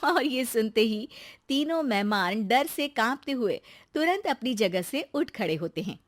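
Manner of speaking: ordinary delivery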